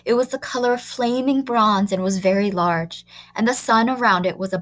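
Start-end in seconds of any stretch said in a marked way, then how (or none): none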